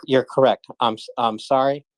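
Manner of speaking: ordinary talk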